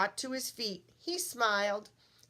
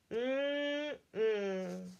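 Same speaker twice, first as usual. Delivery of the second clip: contented yummy hum. Acted. Hungry thing eating.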